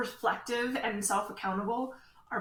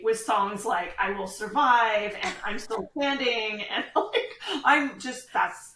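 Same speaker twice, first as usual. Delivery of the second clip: laughing through the words, slower and louder; 2 semitones higher, 7 dB louder than usual. Real, not acted. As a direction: giggle while listing the songs. Laughing about cheeky playlist.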